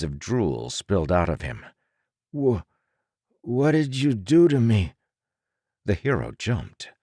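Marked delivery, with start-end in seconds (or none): 2.4-4.9 stammering, higher, frightened plea; sick twin stammers at his maker in fear; disturbed, frightened. A narrator's reading. The sick copy asks what was done to him, frightened. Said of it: "his own voice could be so disturbing".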